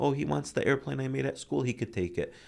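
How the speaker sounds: high, then relaxing to normal; child-like, happily letting it go; easygoing little kid. Retold or imitated. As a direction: lightly imitate a generous child. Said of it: "that's what he used to do".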